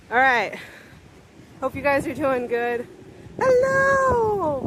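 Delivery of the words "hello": high, bright call across the street. Real, not acted. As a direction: call out a greeting from afar. Calling out to a passerby.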